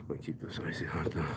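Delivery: whispered aside, unvoiced. Real, not acted. Muttering a whispered aside to himself. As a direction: breathe it as a private aside.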